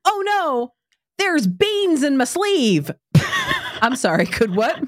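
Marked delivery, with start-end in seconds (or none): 0.1-2.9 put-on high, slow, whiny child's lament; imitated whiny daughter. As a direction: play a small girl wailing over beans in her sleeve. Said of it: "daughter's complaint retold".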